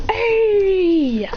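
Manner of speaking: high, loud, drawn-out cry; fighting cry. Acted. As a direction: belt out a sustained battle cry.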